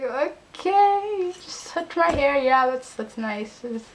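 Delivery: high, drawn-out uneasy okay; retold strained politeness; awkward acceptance. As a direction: stretch it out high, putting up with it. Awkward reaction to hair touching.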